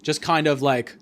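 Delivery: ordinary talk, nothing marked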